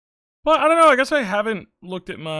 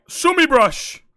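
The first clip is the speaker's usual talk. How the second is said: mock summons; 9 semitones higher, 14 dB louder, much slower than usual. Summoning the brush.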